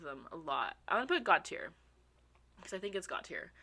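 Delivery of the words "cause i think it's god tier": ordinary talk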